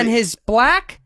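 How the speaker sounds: slow, loud, drawn-out objection; Jordan Peterson. Acted. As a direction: stretch it out loudly with effort. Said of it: "Jordan Peterson?".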